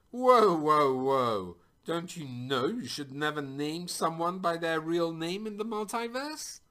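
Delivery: high-pitched cry of alarm, cutting in. A narrator's reading. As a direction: burst in loudly, then stay high in disbelief. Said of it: "who cried out".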